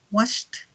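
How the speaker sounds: "washed" as one syllable ending in t; final T. Shown as a demonstration. Teacher drills past forms, "washed" ending in a clear T. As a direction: add a released T to close "washed". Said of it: "Al final suena como una T".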